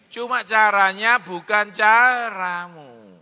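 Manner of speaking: slow, loud, emphatic preaching; preacher driving home the lesson; preaching punchline. Acted. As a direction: land the point hard and slow.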